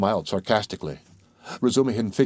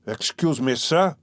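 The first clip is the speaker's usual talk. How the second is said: quivering with fury; 6 semitones higher, slower than usual. A narrator's reading. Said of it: "quivering with fury".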